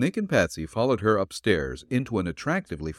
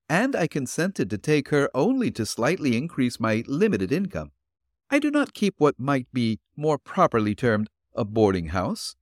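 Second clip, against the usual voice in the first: put-on higher, gentle old woman's voice; elderly landlady voice; 5 semitones higher than usual. Landlady answering the detective at her door, politely.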